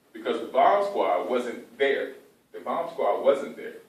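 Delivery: slow, loud, stressed "wasn't there"; emphatic repetition. Real, not acted. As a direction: hammer home "The bomb squad wasn't there". Coach stresses key point.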